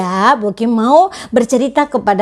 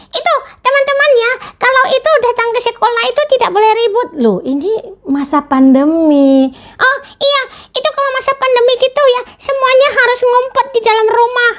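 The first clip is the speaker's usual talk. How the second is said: much higher, fast chattering puppet voice; excited puppet; 13 semitones higher, faster than usual. Acted. Puppet lecturing classmates about pandemic rules, high and fast. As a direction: rattle off the rules as an eager puppet.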